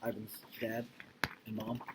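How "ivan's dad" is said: ordinary talk, nothing marked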